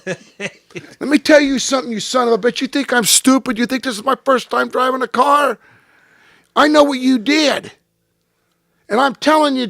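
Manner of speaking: high, loud, furious shout at Jack; furious yell. Retold or imitated. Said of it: "so then I blow up again".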